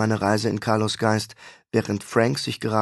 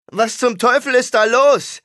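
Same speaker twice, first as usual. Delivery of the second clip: high, loud alarmed yell; 12 semitones higher, 7 dB louder than usual. A narrator's reading. Holly runs up to the pavilion yelling at the shooters. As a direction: yell it while running toward the gunfire. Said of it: "brüllte Holly".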